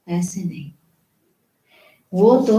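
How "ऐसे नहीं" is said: whispered aside. Acted. Dismissing a wrong belief.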